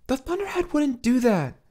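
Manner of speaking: put-on high, firm young woman; Loriana's voice. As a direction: insist it isn't true.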